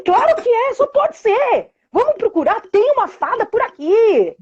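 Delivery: high, animated make-believe pitch; playful showing. Retold or imitated. Mother showing daughter the difference, excited. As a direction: sell the magic with wide-eyed delight. Mother drawing child into game.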